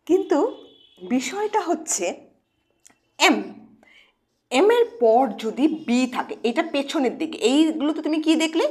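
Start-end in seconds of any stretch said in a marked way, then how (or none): none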